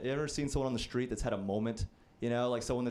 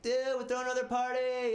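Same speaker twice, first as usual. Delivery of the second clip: high, eager party invite; hyped cousin; 11 semitones higher than usual. Retold or imitated. Cousin inviting him again, high and eager. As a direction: pitch it up like a keen college kid. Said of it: "he's like".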